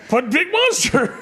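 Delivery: put-on high, drawn-out childish protest; Hulk. Retelling Hulk's best line to a co-host, childish Hulk voice. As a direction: whine it out slowly like a big kid. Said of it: "one of Hulk's best lines".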